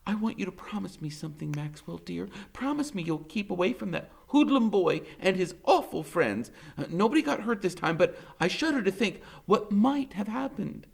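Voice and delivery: put-on high, fussy old lady pleading; grandmother's voice. A narrator's reading. Grandmother begs Max to avoid the hoodlum boy, fretting. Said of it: "Graham says".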